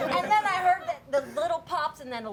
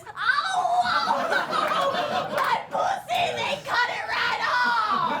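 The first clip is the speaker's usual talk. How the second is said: mock yelping in pain; 11 semitones higher than usual. Shopper acting out fake pain, hammed-up high yelping. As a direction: wail like it was cut off. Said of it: "I'm like this".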